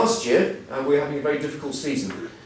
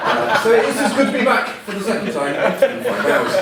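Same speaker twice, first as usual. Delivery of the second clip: laughing; 8 semitones higher, 6 dB louder than usual. Real, not acted. Still laughing at own joke.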